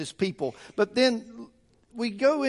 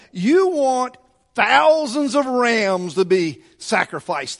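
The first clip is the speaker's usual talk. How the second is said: put-on exasperated teenage sarcasm, pitched up and emphatic; sarcastic; 2 semitones higher, 3 dB louder than usual. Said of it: "like a sarcastic person".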